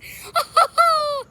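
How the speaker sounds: loud, high villain cackle; big gloating cartoon laugh; evil laugh. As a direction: cackle loudly after a wicked threat. Villain laughs after his threat.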